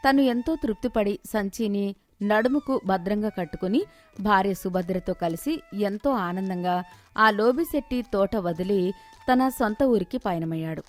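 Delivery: ordinary delivery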